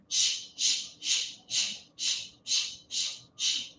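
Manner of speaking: quiet unvoiced shh, pulsing from the belly; rhythmic shushing. Shown as a demonstration. Teacher shows the class the belly shush, soft and rhythmic. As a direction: shush a chatty moviegoer in steady beats. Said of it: "And as we shush".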